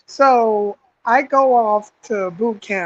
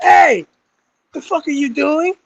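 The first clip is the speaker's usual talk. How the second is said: mock sergeant challenge; 6 semitones higher than usual. Imitating sergeant confronting a recruit.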